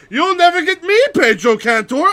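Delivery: high, loud, hammed-up villain taunt ending in a cackle; cartoon villain. Orc villain taunting Pedro Cantor as he escapes, cartoon villain gloating. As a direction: crow it like a Saturday morning cartoon villain. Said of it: "Saturday morning cartoon villain escape".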